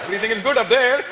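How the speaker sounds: put-on high-pitched Indian accent; Indian dad voice. Dad after screwing up his insult, high Indian-accented voice. Dad's voice continues the bit.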